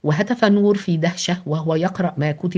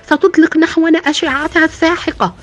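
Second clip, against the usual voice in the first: much higher, loud desperate scream; 10 semitones higher, 6 dB louder than usual. Acted. Minister screams at the president to hurry, desperate scream. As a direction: scream the warning at full force. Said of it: "وصرخ وزير الدفاع مرة أخرى".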